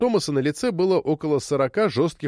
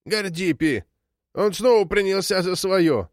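higher, indignant outcry; agitated old woman; 6 semitones higher than usual. A narrator's reading. Old woman waking from a dream, agitated. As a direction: cry out in alarmed complaint.

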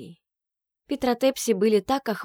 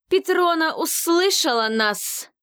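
much higher, loud joyful cry; exclaiming; 9 semitones higher, 4 dB louder, slower than usual. Acted. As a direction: cry out in triumph. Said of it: "воскликнула жрица".

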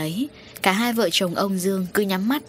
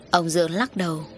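ordinary delivery, nothing marked

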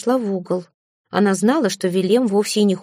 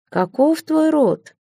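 high, very slow eerie voice; slow, eerie question from the mist; fog spirit; 7 semitones higher, much slower than usual. A narrator's reading.

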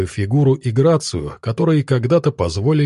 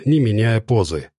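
plain reading voice

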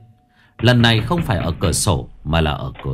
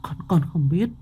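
stammering fear; 9 semitones higher than usual. Acted. Daughter Nhài answering mother, halting frightened girl voice. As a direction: stutter it, pale with fright. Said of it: "tái mặt lắc đầu".

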